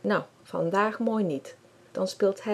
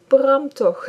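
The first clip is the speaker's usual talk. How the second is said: laughing; 8 semitones higher, 9 dB louder than usual. A narrator's reading. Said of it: "lacht ze".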